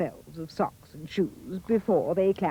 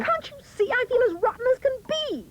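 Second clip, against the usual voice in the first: whiny Cindy; faster than usual. A narrator's reading. Cindy complains to the fairy, high fast whine. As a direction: whine it like a spoilt girl. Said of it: "cried Cindy".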